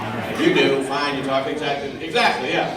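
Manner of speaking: normal talking voice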